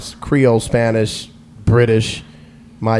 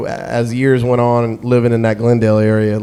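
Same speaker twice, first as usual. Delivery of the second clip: ordinary talk, nothing marked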